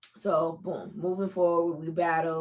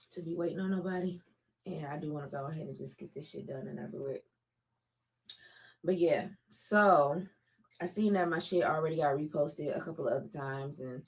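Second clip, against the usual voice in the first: ordinary delivery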